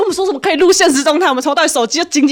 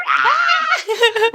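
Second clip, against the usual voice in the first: mock scream; 9 semitones higher than usual. Scared on the pirate ship.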